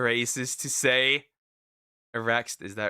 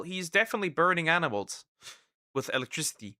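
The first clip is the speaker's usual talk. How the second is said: ordinary delivery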